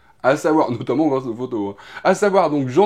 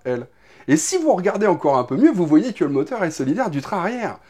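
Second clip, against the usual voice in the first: usual speaking voice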